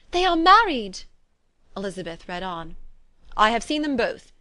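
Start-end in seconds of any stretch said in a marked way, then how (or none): 0.1-1.0 high, loud exclamation; excited cry. A narrator's reading. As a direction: cry out the happy conclusion. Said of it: "cried Jane".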